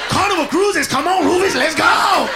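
high, loud fake-cheery pitch; mock cheery salesman. Slaver luring people onto the boat, high cheery mock pitch. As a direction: lure them aboard with a grin.

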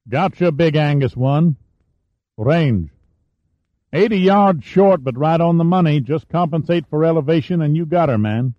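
a relaxed spotter calling the shot; casual gunner. A narrator's reading.

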